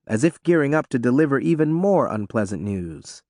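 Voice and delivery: usual speaking voice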